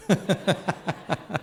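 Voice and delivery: high, bright burst of laughter; hearty laugh. Real, not acted. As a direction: laugh at the joke. Laughs at own joke.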